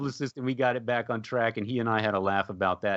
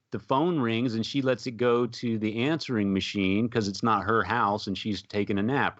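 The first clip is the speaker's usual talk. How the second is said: normal talking voice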